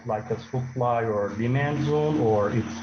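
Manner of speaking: loud and slow, spelled out to be heard. Real, not acted. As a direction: speak up and slow down over a bad line. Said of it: "I barely hear you".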